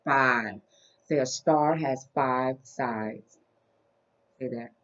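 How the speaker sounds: put-on soft, slow voice for a small child; reading to a toddler. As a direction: count gently with a toddler. Teaching toddler shapes.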